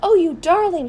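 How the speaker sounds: loud, high-pitched adoring exclamation; delighted cry. A narrator's reading. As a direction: gush over a beloved pet. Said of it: "she cried".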